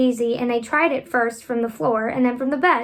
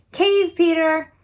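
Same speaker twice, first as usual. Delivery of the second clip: slow, louder and higher warning cry; warning call; 5 semitones higher, 4 dB louder, slower than usual. A narrator's reading. Smallest star warning Peter, calling out. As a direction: call the warning out across the night. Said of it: "the smallest star of all called out".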